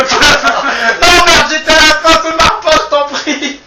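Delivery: loud, high pleading complaint; exasperated shout. Real, not acted. Actor begging his laughing partner, loud exasperated plea. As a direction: beg the partner to stop laughing.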